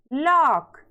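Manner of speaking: लॉक said "laak", vowel open. Shown as a demonstration. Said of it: "इसको पढ़ना है".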